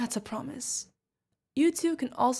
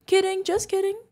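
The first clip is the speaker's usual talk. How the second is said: higher, louder joking reassurance; playful backpedal; 9 semitones higher, 5 dB louder than usual. Acted. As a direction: lift the pitch to take back the tease. Teasing the young companion.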